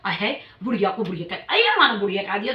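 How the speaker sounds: quick sneering imitation of the woman; fast mocking mimic. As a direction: rattle off her retort mockingly. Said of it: "कह रही". (तेज़ चिढ़ाती नकल)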